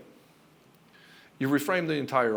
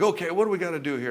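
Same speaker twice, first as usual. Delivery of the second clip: louder, higher mock take-over; taking charge; 6 semitones higher, 5 dB louder, faster than usual. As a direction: imitate barging in to run everything. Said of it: "start taking charge".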